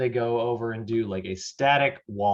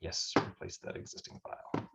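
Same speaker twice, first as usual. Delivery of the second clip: whispery, very soft and low; barely voiced while clicking through; talking to oneself; 4 semitones lower, 13 dB quieter than usual. Real, not acted. Teacher confirming a file save, talking to oneself.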